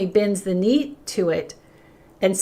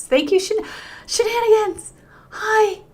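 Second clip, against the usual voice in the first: high sing-song greeting; 13 semitones higher than usual. Real, not acted. Greeting a viewer by name.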